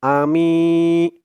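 আমি with the মি long; stretched ami. Shown as a demonstration. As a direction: hold মি slowly. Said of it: "মিম ইয়া জের মি". (আমি-তে মি টেনে)

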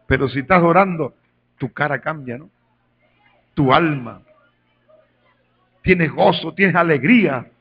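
loud fervent preaching to the flock; preaching at full force. Acted. Pastor urging the congregation, loud and fervent. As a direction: drive it home like a pulpit preacher.

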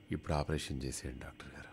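soft breathless whisper. Acted. Lovestruck patient whispering to the doctor to operate now. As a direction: whisper it as if lost in a dream.

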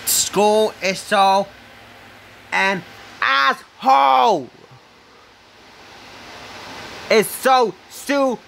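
furious shout about school; screaming. Real, not acted. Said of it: "Sorry for screaming".

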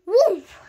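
loud, high burst of impact; punch impact noise. A narrator's reading. Narrator as the fist lands, punch impact noise. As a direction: blurt the sound of a blow landing.

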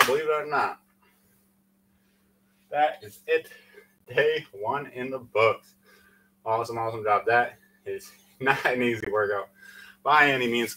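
usual speaking voice

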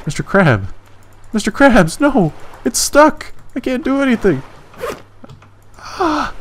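high, loud panicked cries; frantic shouts at a stuck crab; panicked yelling. Real, not acted. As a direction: yelp in real panic as it sticks.

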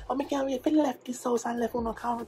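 high-pitched mock Jamaican nagging; arguing Jamaican mom. Jamaican mom arguing with dad at home, high mock rant. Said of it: "you know how Jamaican parents be arguing".

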